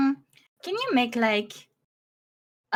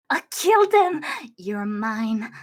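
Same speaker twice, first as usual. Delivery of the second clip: murderous; 8 semitones lower, slower than usual. Pretend. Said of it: "make it sound more murderous".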